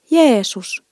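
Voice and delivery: loud, high amazed exclamation; little girl exclaims at the pictures loudly. A narrator's reading. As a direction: call the name out, high, too loud for church. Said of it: "Nanni sanoi aika isolla äänellä".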